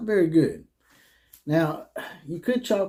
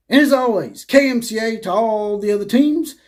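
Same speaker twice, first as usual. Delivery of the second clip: much higher and louder, slowing right down on the last words; loud catchphrase sign-off; 7 semitones higher, 6 dB louder than usual. Real, not acted. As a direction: belt the sign-off like a class bell. Host's loud signature sign-off.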